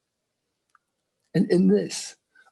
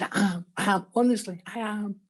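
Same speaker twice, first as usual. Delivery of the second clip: higher, insistent reassurance; eager insisting; 4 semitones higher than usual. Retold or imitated. Husband pleading with his wife to share the Bible reading, eager. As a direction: press her to believe you mean it.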